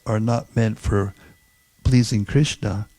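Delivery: ordinary talk, nothing marked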